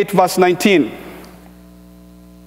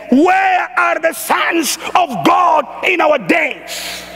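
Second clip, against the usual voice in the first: slow, raised plea from the pulpit; crying out; 6 semitones higher, much slower than usual. Retold or imitated. Preacher voicing creation crying out, slow raised call. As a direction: call out slowly like creation pleading. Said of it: "is eagerly waiting and crying out".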